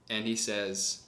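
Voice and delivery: usual speaking voice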